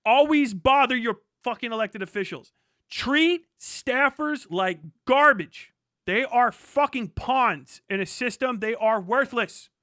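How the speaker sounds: ordinary delivery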